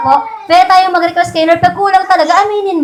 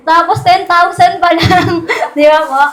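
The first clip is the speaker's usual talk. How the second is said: ordinary talk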